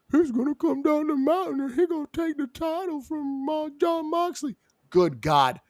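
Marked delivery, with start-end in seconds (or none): 0.1-4.6 high, mocking fanboy voice. Mocking the fans who hype him up, in a giddy high voice. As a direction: parrot the hype like a giddy fan. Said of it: "Now I gotta hear about".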